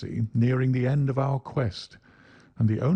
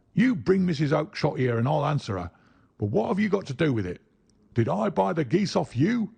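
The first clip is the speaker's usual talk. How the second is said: higher, fast angry retort; 7 semitones higher than usual. Acted. Salesman snapping at the little man, angry. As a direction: fire questions back at him.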